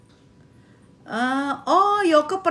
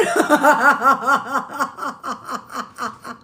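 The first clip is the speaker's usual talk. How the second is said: long laugh; 3 semitones lower than usual. Real, not acted. Long laugh after growling.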